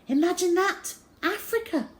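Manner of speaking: high, awed marvel at a far land; breathless wonder. A narrator's reading. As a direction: say it like a child dazzled by the world.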